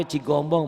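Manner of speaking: Cigombong with a firm G, loud; Cigombong, the G clearly sounded; correct G. Shown as a demonstration. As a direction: say CIGOMBONG with "go" in the middle. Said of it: "bukan Cihombong".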